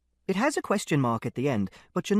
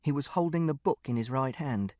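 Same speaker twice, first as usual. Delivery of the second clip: plain reading voice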